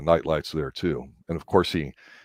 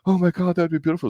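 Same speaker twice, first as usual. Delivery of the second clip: much higher, breathless mock girlish gush; imitating her gushing; 11 semitones higher, faster than usual. Quoting the Bond girl in a high, gushing voice. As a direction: play her dazzled by the dress. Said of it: "she's like".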